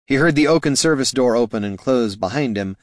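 ordinary delivery

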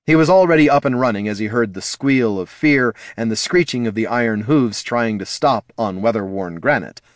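plain reading voice

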